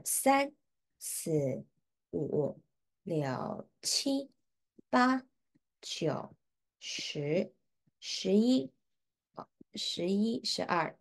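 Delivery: slow, counted stroke by stroke. Shown as a demonstration. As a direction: count each stroke clearly, one by one. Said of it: "演 is".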